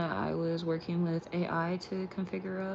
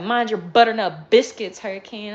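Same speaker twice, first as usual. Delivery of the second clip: loud, sassy put-on voice for the dog; 3 semitones higher, 11 dB louder than usual. The dog Butter mouthing off at the speaker, loud and sassy. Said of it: "Butter was like".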